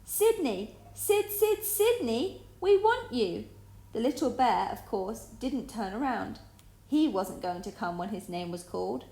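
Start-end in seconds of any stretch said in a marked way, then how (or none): 0.0-3.4 high, loud call; shouting down the mousehole; shouting down the hole. A narrator's reading. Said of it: "shouted down it".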